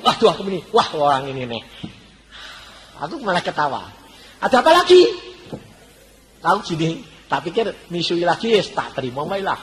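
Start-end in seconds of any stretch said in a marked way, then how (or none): 4.4-5.1 exasperated. Retold or imitated. Couple rings bell at dawn again.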